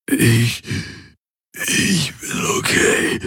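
strained, breathless whisper; groaning. A narrator's reading. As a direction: push the words out through pain. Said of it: "ächzte er". (ächzend vor Schmerz)

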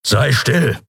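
a sharp hissed hush; hissing snap. Acted. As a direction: shut them up with a hiss. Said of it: "fauchte Keru".

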